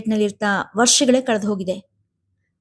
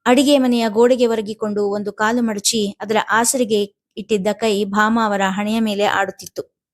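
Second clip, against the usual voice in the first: plain reading voice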